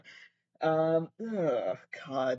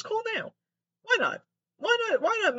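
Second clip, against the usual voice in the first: much higher mock ad announcer; mock commercial pitch. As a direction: sell it like a TV ad. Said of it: "Viagra commercials".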